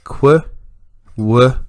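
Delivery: qu and w each as a short, separate sound; isolated sounds. Shown as a demonstration. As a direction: sound out qu, then w, with a clear gap. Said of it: "we can hear the".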